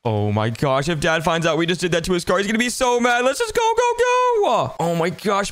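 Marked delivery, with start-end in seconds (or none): 3.2-4.8 high urgent hurry-up cry; panicked yelling. Acted. Fleeing from Dad.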